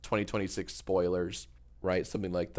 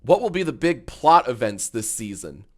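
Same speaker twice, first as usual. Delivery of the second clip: YouTuber voice. Acted. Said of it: "Do you always talk like a radio host".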